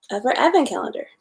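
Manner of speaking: ordinary delivery, nothing marked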